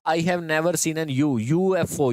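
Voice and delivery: usual speaking voice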